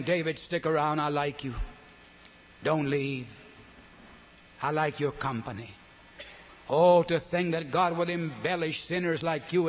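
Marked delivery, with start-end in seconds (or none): 0.0-5.7 tender invitation. Retold or imitated. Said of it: "And God is saying".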